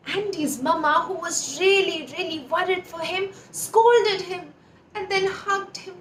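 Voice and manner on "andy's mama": ordinary delivery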